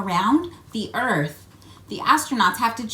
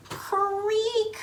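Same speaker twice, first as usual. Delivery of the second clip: high creak sound effect; 12 semitones higher than usual. Pretend. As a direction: squeal "Creak!" high like old wheels.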